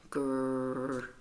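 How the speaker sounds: low, soft dog growl. Acted. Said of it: "Rosie growls".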